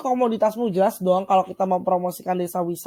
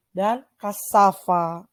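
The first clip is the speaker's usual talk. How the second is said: slow vocabulary model; much slower than usual. Shown as a demonstration. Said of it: "gimana cara aku ngomong".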